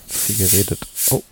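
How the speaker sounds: while sipping a drink. Real, not acted. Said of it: "ich muss noch mal was trinken hier".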